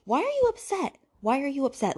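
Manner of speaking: high-pitched incredulous demand; exasperated questioning. Real, not acted. Vlogger scolding Pippa for being upset, exasperated. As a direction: challenge her, pitch leaping up in disbelief.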